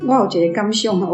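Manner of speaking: ordinary talk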